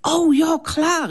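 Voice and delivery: put-on excited kid's cry; calling out. A narrator's reading. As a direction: burst out with delight. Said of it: "ruft der Jannik".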